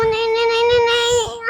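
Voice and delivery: whimpering no, no, no through tears; tearful protest. Acted. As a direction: sob out the refusals. Child refusing treatment, crying.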